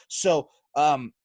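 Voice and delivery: usual speaking voice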